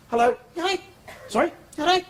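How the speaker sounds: high, chirpy mock politeness; awkward greeting. Comic imitating the forced hellos between strangers, high and chirpy. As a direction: squeak out fake-friendly hellos.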